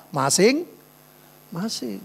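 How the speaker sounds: "Masing-masing" stretched out very slowly; slow, drawn-out. Real, not acted. Pastor repeats his motto to the congregation, slow and drawn out. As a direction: linger on each syllable of "Masing-masing".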